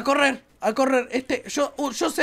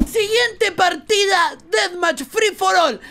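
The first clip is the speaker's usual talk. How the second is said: slow dramatic announcer; drawn out like a game announcer; 6 semitones higher, 3 dB louder, slower than usual. Acted. Announces next match dramatically.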